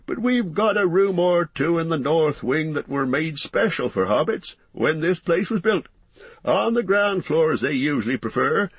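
high-pitched landlord rattling on sociably; chatty, bustling innkeeper. Acted. Landlord apologising to guests for the crowd, bustling chatter. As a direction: talk on in a high, busy character voice.

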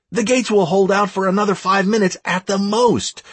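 rally the troops with a high, pressing call; shouting orders. A narrator's reading. Raid leader to players storming the village, shouting orders. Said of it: "I continued to shout orders left and right".

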